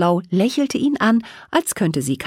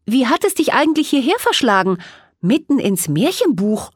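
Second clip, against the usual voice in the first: put-on higher, bright-eyed girl asking eagerly; curious; 7 semitones higher, 3 dB louder than usual. A narrator's reading. Mona asks the fairy how she got here, curiously. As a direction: lean in, curious and a little quick. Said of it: "fragte Mona neugierig".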